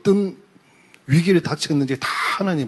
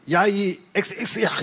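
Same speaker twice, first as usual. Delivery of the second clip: retold outburst, pitched up; mock cursing; 5 semitones higher than usual. Deaconess cursing at her husband, mock angry outburst. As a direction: imitate an angry wife swearing in censored words. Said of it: "이러면서 막 난리 나는 거예요".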